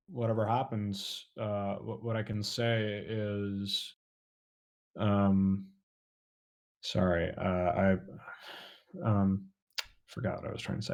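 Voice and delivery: fading to near silence; losing the thought, voice dropping away; trailing off. Real, not acted. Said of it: "I lost my train of thought".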